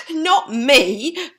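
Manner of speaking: high, loud giggly "Not me"; giggling pig. Acted. Boris joining in the boast, high giggle. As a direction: squeak it out with a giggle. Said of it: "giggled Boris".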